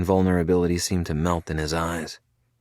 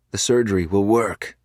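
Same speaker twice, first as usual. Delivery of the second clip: ordinary delivery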